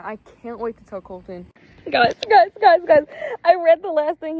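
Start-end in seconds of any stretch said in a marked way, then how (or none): 1.9-4.4 high loud "guys" called again and again; urgent calling. Real, not acted. Calling out to her viewers after reading the reply, loud urgent calling. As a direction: grab the viewers' attention, urgently.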